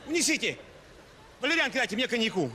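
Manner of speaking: ordinary talk, nothing marked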